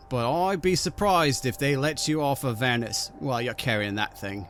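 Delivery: ordinary talk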